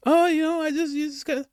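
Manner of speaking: mock interviewee. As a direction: parody her rambling in a high voice. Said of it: "Biden-esque nonsense".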